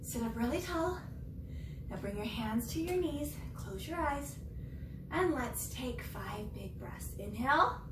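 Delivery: gentle meditation voice; guided breathing. Acted. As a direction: guide the children into calm. Leads breathing cool-down.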